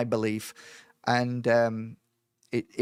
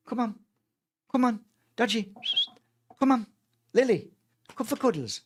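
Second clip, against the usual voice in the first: high-pitched sing-out to a pet; coaxing pets; 9 semitones higher than usual. Real, not acted. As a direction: call the animals over for cuddles. Calls pets over for cuddles.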